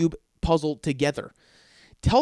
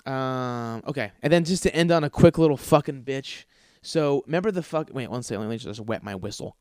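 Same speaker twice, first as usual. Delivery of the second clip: ordinary delivery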